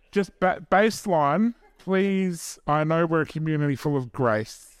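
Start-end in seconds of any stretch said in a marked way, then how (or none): none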